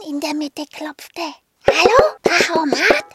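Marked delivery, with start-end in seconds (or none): 1.7-3.0 much louder call, knocking at a door. Acted. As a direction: call out to wake the machine. Knocking and calling at machine.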